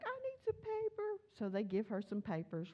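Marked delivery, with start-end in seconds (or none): 0.0-1.2 put-on much higher, a small child asking for paper; little girl voice. Preacher imitating daughter in the car, high child voice. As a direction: pipe it up like a toddler. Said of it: "Jim's like".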